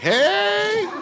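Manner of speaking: high, drawn-out "heeey"; excited lions greeting. Retold or imitated. Woman's lions greeting her at the zoo, high drawn-out squeal. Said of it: "they were like".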